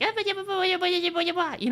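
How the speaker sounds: high babbling gremlin voice; gremlin gibberish. Retold or imitated.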